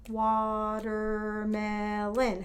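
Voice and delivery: "Watermelon" drawn out slowly, four separate beats; syllables tapped out. Shown as a demonstration. Teacher tapping syllables for the class, very slow. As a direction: tap out "Watermelon" one syllable per finger. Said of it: "Tap it out".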